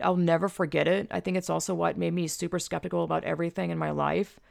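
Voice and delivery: ordinary talk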